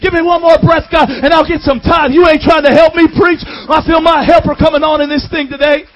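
loud chanted plea, building to a shout; full-force preaching. Real, not acted. Pleading prayer built into shouting.

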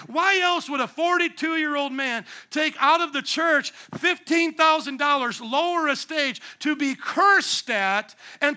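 loud, high preaching build, slowing at the end; full-voiced pulpit crescendo; impassioned preaching. Real, not acted.

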